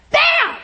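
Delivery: sudden high, loud bam; explosive imitated gunshot; one sharp shouted bang; gunshot bang imitation. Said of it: "it sounds like a gunshot".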